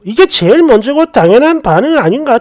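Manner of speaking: ordinary delivery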